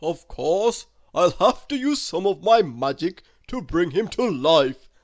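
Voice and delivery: loud hearty Santa voice to himself; Santa musing. A narrator's reading. Santa deciding to use his magic: loud musing Santa voice. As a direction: think it aloud like a jolly old man. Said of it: "thought Santa Claus to himself".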